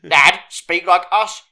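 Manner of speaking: loud, slow demand; playfully exasperated, each word hammered out; mock telling-off. Acted telling-off of Dad.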